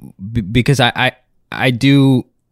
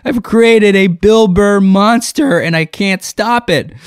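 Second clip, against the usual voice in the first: high, anguished self-reproach; hammed-up dismay, slowing at the end; mock horror; 8 semitones higher than usual. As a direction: wail it like a melodramatic mad inventor. Dramatizes his own horrified thought.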